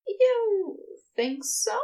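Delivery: high, drawn-out, hopeful question; shyly pleased. A narrator's reading. Ezra to Crowley after the compliment, shyly pleased.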